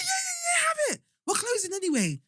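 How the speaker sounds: very high-pitched eager squeal; imitated over-excitement. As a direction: mimic someone gushing to give it away. Retold overexcited pizza man.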